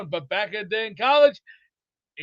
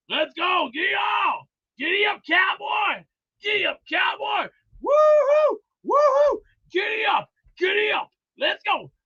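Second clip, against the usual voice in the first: high-pitched giddy-up calls; cowboy hollering; 10 semitones higher than usual. Pretend. Playing cowboy for Tennessee, high hollering. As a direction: urge the horse on with high whoops. Plays cowboy urging on a horse.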